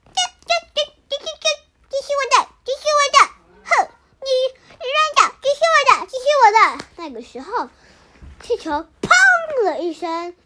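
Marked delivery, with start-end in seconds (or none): 0.1-6.6 squabbling chicks. Acted. Chicks squabble over balloon.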